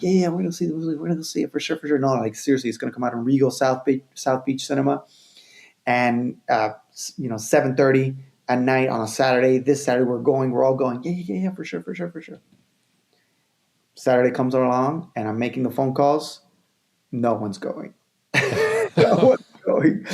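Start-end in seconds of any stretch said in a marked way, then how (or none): 0.0-2.0 higher, hurried imitation of friends agreeing; noncommittal agreement. Friends humouring him about the movie, noncommittal agreement. Friends brushing off his invitation. 11.0-12.4 high, soft imitation of friends agreeing; noncommittal agreement. Friends promising to come along Saturday, noncommittal agreement. 18.3-20.1 high, slow words through real laughter; laughing. Real, not acted. Telling how all the friends bailed, laughing.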